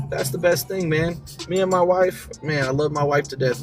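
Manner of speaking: usual speaking voice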